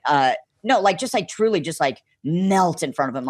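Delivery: usual speaking voice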